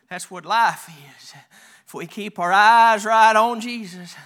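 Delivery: ordinary talk, nothing marked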